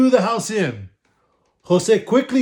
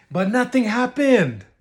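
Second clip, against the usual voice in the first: plain reading voice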